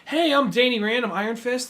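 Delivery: high-pitched clueless self-introduction; Danny Rand, oblivious. Retold or imitated. Co-host mimicking a TV hero introducing himself, oblivious. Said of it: "like, kind of oblivious".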